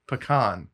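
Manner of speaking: "pecan" as puh-KAHN; puh-KAHN pronunciation. Shown as a demonstration. Citing the dictionary to the team, "pecan" as puh-KAHN. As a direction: say "pecan" with a weak first syllable, then KAHN. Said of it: "they say specifically pecan".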